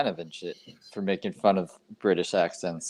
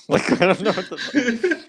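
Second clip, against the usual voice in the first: laughing; 11 semitones higher than usual. Real, not acted. Laughing about the autoplay.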